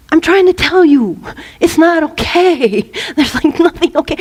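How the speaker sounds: lightly acted anxious objection; worrisome part. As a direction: protest as a fretting worrier. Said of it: "the worrisome part is like".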